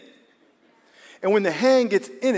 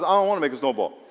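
reluctant talking glove; 3 semitones higher, 5 dB louder than usual. Acted. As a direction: playfully act out a glove that refuses to cooperate. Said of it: "the glove says".

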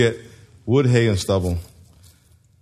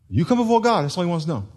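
ordinary talk, nothing marked

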